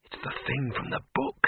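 whispered hiss, no voice; tense whisper near something dreadful. A narrator's reading. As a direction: hiss it under your breath in alarm. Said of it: "he hissed".

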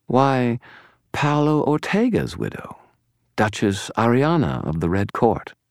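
high, gleeful villain reveal; widening smile. A narrator's reading. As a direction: savour each name with high, mock-innocent delight. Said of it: "His smile widened".

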